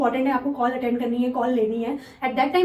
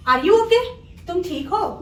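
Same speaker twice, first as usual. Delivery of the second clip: high, loud, anxious are you okay; concerned higher louder ask; urgent concern; 5 semitones higher, 3 dB louder, slower than usual. Shown as a demonstration. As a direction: call out in sudden worry. Acted caring question.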